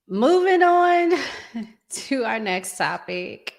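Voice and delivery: mock announcer. Host switching topics in announcer voice.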